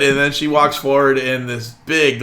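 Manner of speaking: usual speaking voice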